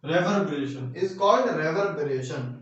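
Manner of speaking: very slow, word by word, for note-taking; slow dictation. Shown as a demonstration. Teacher dictating a definition to students, slowly word by word. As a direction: spell the definition out so students can write it.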